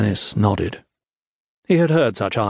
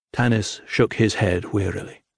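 plain reading voice

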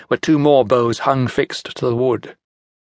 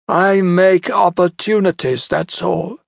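Centaur smug; 7 semitones higher, slower than usual. Acted. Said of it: "said Centaur".

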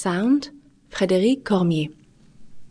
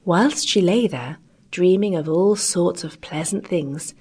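usual speaking voice

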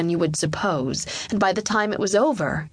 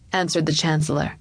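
ordinary delivery, nothing marked

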